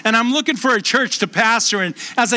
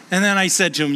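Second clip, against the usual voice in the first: ordinary talk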